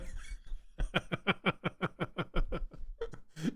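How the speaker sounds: long wheezing fit of laughter; real drawn-out laugh; long helpless laugh. Real, not acted. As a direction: keep cracking up, without words. Laughing at his silly song.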